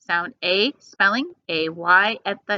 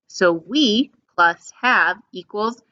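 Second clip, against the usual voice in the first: slow, even modeling of the contraction; slow model read; slower than usual. Shown as a demonstration. Teacher modeling the contraction slowly for the class. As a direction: read it slowly for the kids to copy. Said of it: "You give it a shot".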